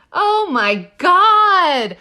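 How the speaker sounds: loud, high, drawn-out shock; real horrified astonishment, slow and loud; appalled amazement. Real, not acted. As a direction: stretch it out loud, aghast. Reacting to gory video.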